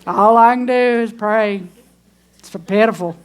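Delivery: lightly mock the tired believer's self-pity; preacher mocking a despairing believer, mild whine; mock whiny complainer. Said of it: "God is there like".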